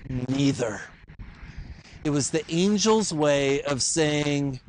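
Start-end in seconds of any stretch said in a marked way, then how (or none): 0.1-0.8 mighty angel. Acted. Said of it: "and he says".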